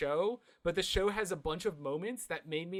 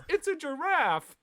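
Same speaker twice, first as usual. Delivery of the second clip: much higher, slow dopey cry; proudly and wrongly announce it; dumb Patrick; 11 semitones higher, 5 dB louder, slower than usual. Retold or imitated. Co-host echoing Patrick's wrong guess, dopey high cry. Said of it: "stupid Patrick goes".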